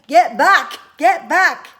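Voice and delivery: loud urgent yell; yelling warning. Acted. Father yelling at his children to turn back. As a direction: scream a warning to stay away. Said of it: "waving and yelling".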